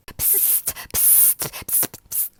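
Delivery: unvoiced spitting psst sounds. The lizard spits out the coffee with harsh psst sounds. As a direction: spit the coffee out. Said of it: "he spat".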